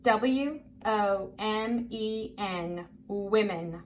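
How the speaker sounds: slow, letter by letter, then the word; measured spelling drill, each letter apart. Shown as a demonstration. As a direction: spell it slowly for a young reader. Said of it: "You do it with me".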